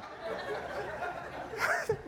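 silent wheezing laugh; high breathless laugh. Real, not acted. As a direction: laugh hard at yourself. Laughs at own story.

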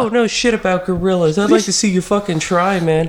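high, loud bro retort; mock Joe Rogan. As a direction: fire back like a cocky fighter.

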